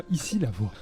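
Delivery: low, booming radio voice; extremely deep bass voice. Pretend. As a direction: deepen it like a station ident. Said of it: "Moi, je suis". (voix grave d'annonceur)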